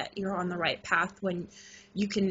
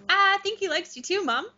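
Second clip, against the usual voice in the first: put-on much higher, a light boyish voice; son's voice; 11 semitones higher than usual. Son telling his mom goodnight, in a high boyish voice. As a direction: lightly voice your young son, gently teasing.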